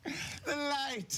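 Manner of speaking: high hammed-up cry about the light; mock blinded. Interviewer teasing the actor about the light, high mock cry. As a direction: shriek like a vampire in sunlight.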